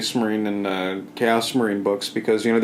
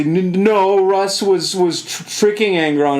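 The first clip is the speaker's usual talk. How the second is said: higher-pitched imitation of protesting fans; mock protest; 7 semitones higher, slower than usual. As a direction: stammer out a mock objection. Imitating protesting Space Wolves players.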